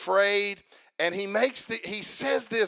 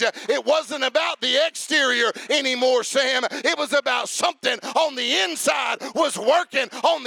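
climbing to a shouted testimony; fiery testimony over music; preaching at full force; 4 semitones higher than usual. Real, not acted.

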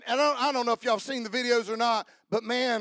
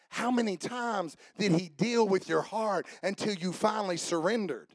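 normal talking voice

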